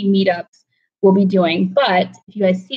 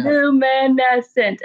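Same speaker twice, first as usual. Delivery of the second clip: put-on dreamy kid voice; 4 semitones higher, much slower than usual. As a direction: stretch "luminescent" even slower, hushed awe. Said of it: "She's like".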